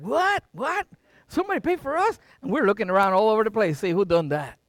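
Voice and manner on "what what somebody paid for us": high, rising incredulous questions; astonished. Retold or imitated. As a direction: re-enact disbelief with a leap in pitch. Retold shock at paid dinner.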